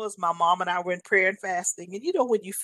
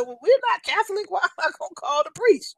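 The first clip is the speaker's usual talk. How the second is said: high, louder incredulous mother, laughing; mother's voice; 11 semitones higher, 4 dB louder than usual. Retold or imitated. Mother scoffing at nurse's priest suggestion, high and laughing. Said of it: "and my mother said".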